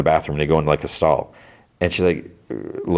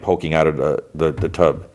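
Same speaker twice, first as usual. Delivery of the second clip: ordinary talk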